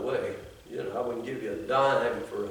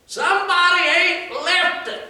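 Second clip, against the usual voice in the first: high, loud West Virginia hillbilly twang; old carpenter griping in a West Virginia drawl; West Virginia hillbilly accent; 8 dB louder than usual. Retold or imitated. Recalling the old West Virginia carpenter, in a hillbilly accent. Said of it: "I can still hear that hillbilly accent".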